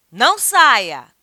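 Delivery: very loud, stretched order; forceful, emphatic command; stern command. Shown as a demonstration. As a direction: forbid it loudly and slowly.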